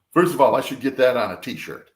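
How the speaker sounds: normal talking voice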